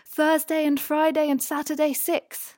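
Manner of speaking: loud, high-pitched cry; sudden ringing shout, held high; shouting at full lungs. A narrator's reading. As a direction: belt it out high and forceful. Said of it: "shouted at the top of his lungs".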